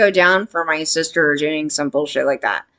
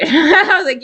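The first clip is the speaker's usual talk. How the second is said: laughing; 10 semitones higher, 4 dB louder than usual. Real, not acted. Laugh while setting up quote.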